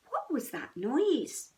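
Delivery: ordinary delivery, nothing marked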